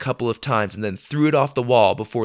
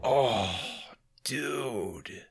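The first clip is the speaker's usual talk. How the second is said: weak, soft moan after a fall; winded, quiet complaint; dazed groan; 10 dB quieter than usual. Acted. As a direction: groan it faintly after hitting the floor. Dazed after landing hard.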